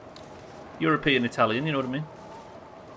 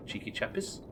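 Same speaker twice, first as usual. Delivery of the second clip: muttered whisper; 4 semitones higher, 10 dB quieter than usual. Real, not acted. Muttered guess at chat.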